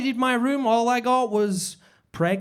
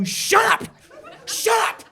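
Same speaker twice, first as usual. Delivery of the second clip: yelling; 10 semitones higher, slower than usual. Acted. Yelling at Santa heckler.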